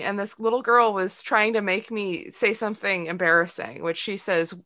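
ordinary delivery

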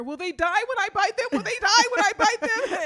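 squealing high panicked question. Retold or imitated. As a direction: shriek it in nervous panic. Panicked question to partner before biting worms.

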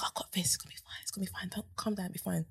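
whispered reassurance, soft and steady; hushed, calm her down. Retold or imitated. Speaker calming a panicking colleague on the train, whispered. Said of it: "I'm like".

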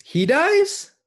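high incredulous squeak; shocked disbelief. Real, not acted. Friend reacting to the plot idea, shocked high squeak. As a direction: leap up in disbelief. Shocked question at suggested death.

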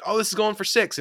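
high-pitched imitation of a hopeful fan; mock excitement. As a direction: act out getting your hopes up. Voicing fans watching a breakaway run.

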